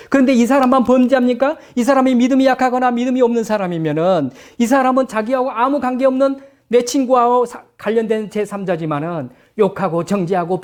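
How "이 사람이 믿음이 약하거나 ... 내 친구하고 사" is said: ordinary talk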